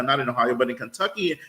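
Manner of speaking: ordinary talk, nothing marked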